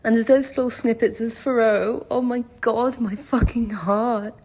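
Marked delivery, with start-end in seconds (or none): none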